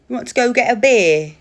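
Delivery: hammed-up British chat-up line; mock British accent. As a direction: put on a loud British accent. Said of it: "a British fella".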